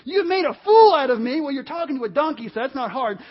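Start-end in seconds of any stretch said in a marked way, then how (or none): 0.0-1.4 loud, high indignant shout; hammed-up furious yell; ticked-off Balaam. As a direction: explode at the donkey in outrage. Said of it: "he gets so ticked off".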